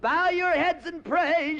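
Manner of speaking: high, panicky woman's cry; mock panic. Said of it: "one woman screaming".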